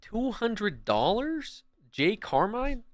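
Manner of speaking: can't believe the amount, stretch it out slowly; stunned disbelief. Real, not acted. Shocked by a big donation.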